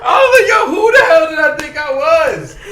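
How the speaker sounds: loud high laughing yell at himself; cracking up loudly in disbelief; laughing shout. Retold or imitated. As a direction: shout it through laughter, scandalized. Laughing at his own nerve.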